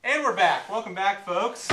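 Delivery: big bright late-night host voice; talk show host. Acted. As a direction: welcome the crowd back like a TV host.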